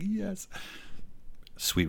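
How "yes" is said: much higher, quiet triumph; high triumphant yes. Retold or imitated. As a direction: savor the win. Triumphant reaction retold.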